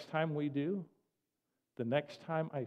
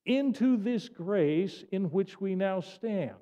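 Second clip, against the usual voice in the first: preaching at full force; 3 semitones higher, 4 dB louder, slower than usual. Acted. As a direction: proclaim it to the congregation. Preaching build.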